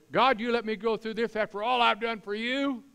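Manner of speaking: retold indignant outburst, high and loud; mad at God. Retelling his fist-shaking complaint to God, mock indignant. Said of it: "when I got mad at God".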